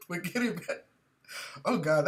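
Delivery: ordinary talk, nothing marked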